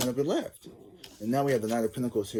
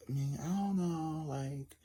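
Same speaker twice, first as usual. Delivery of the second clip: soft, higher, slow and unsure; hesitant quiet; 6 semitones higher, 7 dB quieter, slower than usual. Retold or imitated. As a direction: hold back and hesitate softly. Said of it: "she was like".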